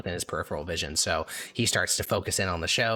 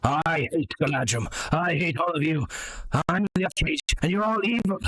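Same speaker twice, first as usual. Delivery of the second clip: a cartoon villain puppet ranting; 8 semitones higher than usual. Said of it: "the puppet says".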